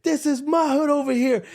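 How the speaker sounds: high mock street bravado; tough-guy threat. Customer mocked for napkin turf claim, high hammed bravado. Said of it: "repeat of tough guy".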